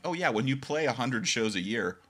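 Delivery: amused realisation. Retold or imitated. Meeting the band, amused wry lift. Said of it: "and I'm like".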